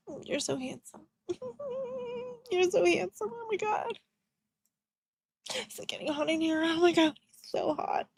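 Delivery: high, soft mock swoon with giggles; flirty swooning. Mocking her flirting with Charlie, high giggly swoon. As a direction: gush like a smitten admirer. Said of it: "tricky things that she would do".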